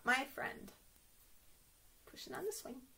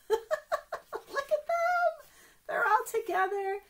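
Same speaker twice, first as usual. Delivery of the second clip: laughing; 12 semitones higher, 4 dB louder, slower than usual. Real, not acted. Laughing at picture.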